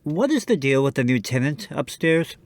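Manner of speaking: usual speaking voice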